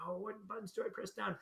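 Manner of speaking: puzzled beginner. Retold or imitated. Teacher imitating a beginner, lightly puzzled. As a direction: wonder aloud like a lost new player. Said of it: "who's thinking".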